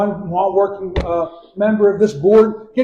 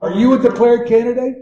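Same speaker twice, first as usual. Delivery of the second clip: louder, higher, spelled out clearly; to someone hard of hearing; 6 semitones higher, 5 dB louder than usual. Real, not acted. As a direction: raise your voice so the back row hears. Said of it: "Speak up, please".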